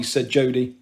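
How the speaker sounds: ordinary delivery, nothing marked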